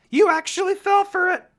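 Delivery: high, loud gleeful jeer; mocking laugh. A narrator's reading. Naruto jeering at the sensei with a laughing, high voice. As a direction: crow it, delighted the prank worked. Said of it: "Naruto laughed".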